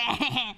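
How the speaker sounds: mischievous mock chuckle; put-on wise-guy laugh; imitated brother's laugh. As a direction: laugh like a kid pleased with their own prank. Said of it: "point at the wrong one and laugh".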